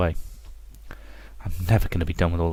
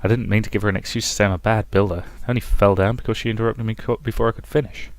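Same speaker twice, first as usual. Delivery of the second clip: ordinary talk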